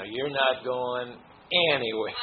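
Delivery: slow, drawn-out words with an amused edge; stuck in molasses. Acted. Teacher likens love for Krishna to thick molasses, slow and stuck. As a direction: stretch it out as if mired in syrup.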